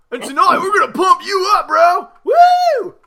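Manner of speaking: fast, loud pumped-up shout; gym bro hype. Acted. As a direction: yell it like a meathead.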